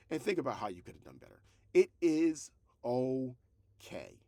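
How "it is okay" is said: slow reassurance. Real, not acted. Reassuring the struggling creators.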